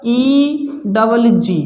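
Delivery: letters stretched out one by one; slow spelling out. Shown as a demonstration. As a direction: spell E double G very slowly. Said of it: "फिर मैंने कहा".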